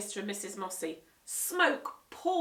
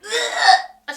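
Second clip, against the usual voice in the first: higher, much louder disgusted ugh; loud splutter; 13 semitones higher, 17 dB louder than usual. Acted. Guest spitting poisoned drink, loud disgusted ugh. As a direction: spit the drink out. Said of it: "she spluttered".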